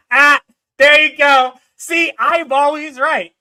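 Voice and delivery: loud, slow triumphant gloat. Real, not acted. Husband gloating to viewers that he's always right, loud. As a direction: crow over being right.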